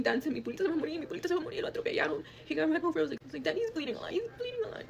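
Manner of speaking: high, tearful plea to dad; distraught child pleading; retold bawling. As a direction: cry out for help, pitch climbing. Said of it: "I'm bawling my eyes out".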